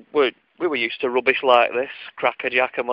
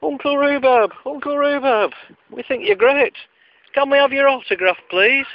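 calling through the door; 12 semitones higher than usual. Retold or imitated. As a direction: call out to him eagerly like a child. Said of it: "I shouted out through the door".

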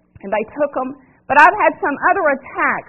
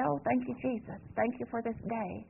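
much softer, offhand mumbled thanks; lazy prayer; 2 semitones lower, 18 dB quieter than usual. Pretend. Pastor mocking a lazy morning prayer, mumbled and offhand. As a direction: toss off a careless little prayer. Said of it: "Not just get up and say".

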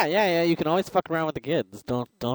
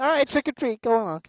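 high mock voice; 11 semitones higher than usual.

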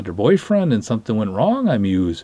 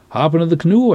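calling out; 7 semitones higher, 5 dB louder than usual. Retold or imitated. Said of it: "I say".

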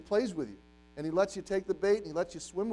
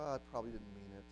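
low, soft, quick excuse; dismissive giving up; 6 semitones lower, 11 dB quieter, faster than usual. Acted. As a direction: toss it off like a feeble excuse. Voicing someone giving up on decision.

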